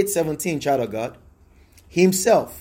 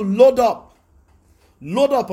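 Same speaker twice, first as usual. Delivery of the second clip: rallying cry; 5 semitones higher, 3 dB louder, slower than usual. Real, not acted. Rallying call to congregation.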